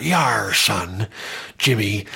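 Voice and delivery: broad pirate-ish West Country "Yar" drawn out; a West Country accent played for laughs; exaggerated West Country accent. Shown as a demonstration. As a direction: ham up a Bristol yokel accent like a seafarer. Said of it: "The exaggerated version of my accent is that".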